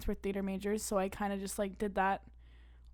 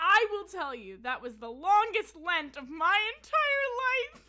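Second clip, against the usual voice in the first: laughing; 8 semitones higher, 9 dB louder, slower than usual. Real, not acted. Laughing over the Lent story.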